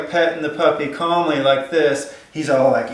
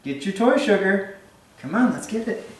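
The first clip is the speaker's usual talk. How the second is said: much higher, sing-song call to the dog; 7 semitones higher than usual. Real, not acted. Trainer calling her dog Sugar to bring her toy, high coaxing call. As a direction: coax the dog over, pitched way up.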